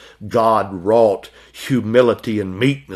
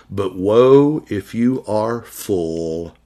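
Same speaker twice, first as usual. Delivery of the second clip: "woe" leaned on, drawn out very slowly; grave warning; slow solemn woe; much slower than usual. Acted. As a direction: sound out the warning weightily. Preacher voices Christ's warning.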